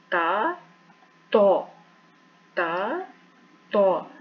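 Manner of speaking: ता light and thin, तो heavy with open mouth; thin versus thick letter. Shown as a demonstration. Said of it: "लेकिन ता भी नहीं बोलना".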